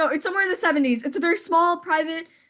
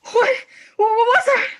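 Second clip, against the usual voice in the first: high, loud, sharp alarm at a sudden noise; frightened cry of what was that; startled fright; 6 semitones higher, 6 dB louder than usual. Acted. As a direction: jump at the click on the line. Hears a click on the line.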